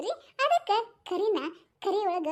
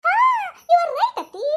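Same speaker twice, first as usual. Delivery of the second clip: shout; 7 semitones higher, 7 dB louder, slower than usual. Acted. Loud agreement with laugh.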